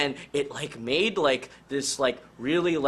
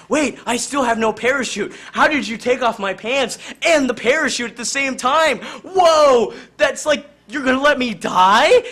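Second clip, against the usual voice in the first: alarmed realization; 8 semitones higher, 7 dB louder than usual. Acted. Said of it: "And Jonesy realized that".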